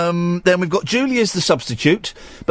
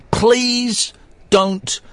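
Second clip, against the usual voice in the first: slow, deliberate warning; strict warning; 4 semitones higher than usual. Real, not acted. Host warning callers about the rules, strict. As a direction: lay down the rule word by word. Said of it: "gonna be really strict about this".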